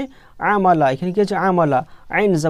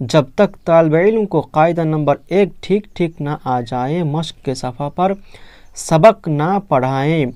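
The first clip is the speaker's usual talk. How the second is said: slow reading aloud; slower than usual. Shown as a demonstration. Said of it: "এখানে বলছে".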